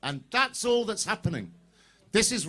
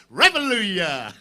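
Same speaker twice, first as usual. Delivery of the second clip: rally cry; 6 dB louder than usual. Real, not acted.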